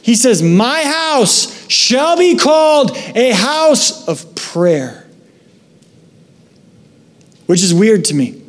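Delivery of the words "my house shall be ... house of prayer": slow, high, declaring call; Jesus calling out in the temple; proclaiming yell. Retold or imitated. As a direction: proclaim it over the crowd. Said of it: "he yells in the middle".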